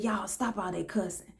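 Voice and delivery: low, soft retold reprimand; hushed, mild telling-off; quiet reproof. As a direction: voice a restrained officer chiding colleagues. Officer gently scolding his colleagues.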